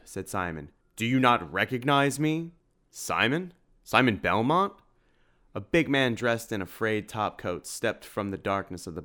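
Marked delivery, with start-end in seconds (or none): 0.9-4.7 higher and louder, rising; can't believe he's forgotten; indignant surprise. Acted. Simon insists he is known.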